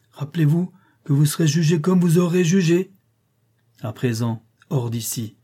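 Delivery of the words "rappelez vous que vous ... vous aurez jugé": high, loud, insistent warning; emphatic admonition. Acted. Rochester warns those judging him, in emphatic admonition. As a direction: drive the reminder home with raised voice.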